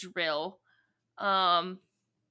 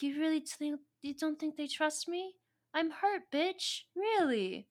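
high, softer mock-wounded voice; mock hurt; 6 semitones higher, 5 dB quieter than usual. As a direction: play hurt, pitched up high. [name] acts wounded at distrust.

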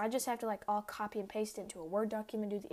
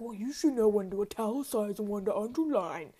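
louder, lecturing teacher voice; mock stern teacher; 8 dB louder than usual. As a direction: scold like a smug teacher. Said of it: "and he's like".

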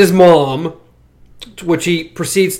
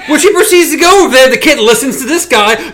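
normal talking voice

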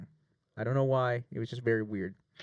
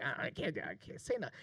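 a much softer, sheepish mumble; evasive mumble; 11 dB quieter than usual. Retold or imitated. Grayson refusing to back his friend, softly mumbling. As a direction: shrink back, unwilling to take sides. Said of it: "And he was like".

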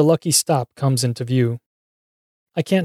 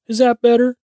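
much higher, sing-song taunting question; mock concern; 11 semitones higher, 4 dB louder than usual. A narrator's reading. Mac stepping towards the beaten man, mock concern. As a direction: taunt him with a high, lilting question. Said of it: "asks Mac as he steps towards me".

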